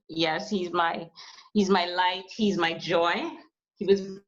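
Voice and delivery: usual speaking voice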